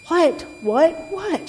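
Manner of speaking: rising, high-pitched impatient fox; mock shout, climbing in pitch; shouted exasperation. A narrator's reading. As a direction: repeat it with mounting exasperation. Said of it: "shouted the fox".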